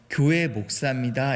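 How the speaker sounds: 교회 목사입니다를 한 자씩 힘주어; 또박또박 천천히. Retold or imitated. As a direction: stress on 교회, slow and deliberate. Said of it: "이렇게 얘기하니까". (교회를 또박또박 천천히 강조해서)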